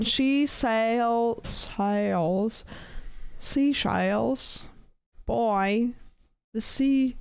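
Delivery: Australian attempt sliding into Southern drawl; halting Southern vowels, word by word; accidental Southern accent. Shown as a demonstration. Streamer showing failed Australian attempt, comes out Southern accent. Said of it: "like, southern".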